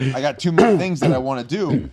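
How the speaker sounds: fired up. Retold or imitated. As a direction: burst out like someone bursting with drive. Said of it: "all of a sudden they're fired up".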